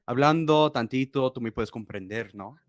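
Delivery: fast, lightly put-on Spanish; mock Spanish pitch. Streamer flirting in Spanish with his date, playful.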